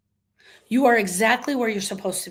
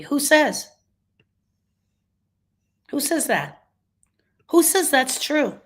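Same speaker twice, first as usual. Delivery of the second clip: indignant challenge; 5 semitones higher than usual. Acted. Challenging the should statement.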